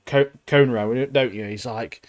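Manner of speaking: usual speaking voice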